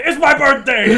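loud, high, laughing announcement; mock birthday excitement. Playing the officer with happy news, mock excited. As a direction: crow it like a celebration. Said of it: "It was gonna be".

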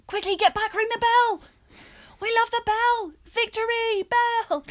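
high, loud hammed-up cheering; mock excitement. Mocking school pride over the victory bell, high excited shouting.

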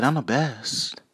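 a light DJ hype call; [name]. Retold or imitated. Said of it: "Like D J Khaled".

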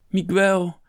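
high, loud cry of the name; calling out. A narrator's reading. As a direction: shout his name urgently. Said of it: "riep de abt".

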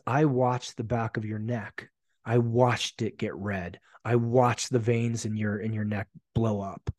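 measured, slow retold accusation; slow, deliberate confrontation. Group member confronting him on his hidden anger, slow and deliberate. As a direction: lay out each observation slowly and deliberately.

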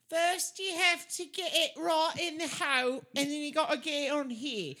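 high, bright put-on fair-worker voice; high imitation of the game attendant; imitating the game girl. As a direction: mimic the girl running the game. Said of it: "the girl was like".

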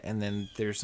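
ordinary delivery

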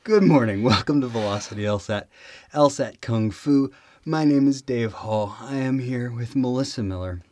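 upbeat show opening; podcast host intro. Acted. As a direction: greet the listeners like a show host.

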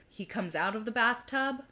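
normal talking voice

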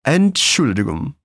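Entschuldigung slow, with a slight rise; slow model word. Shown as a demonstration. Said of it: "repetir mais uma vez".

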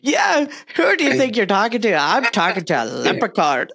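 high-pitched imitation Irish; mock Irish accent. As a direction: parrot the line back in playful Irish. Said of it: "talk in an Irish accent like you".

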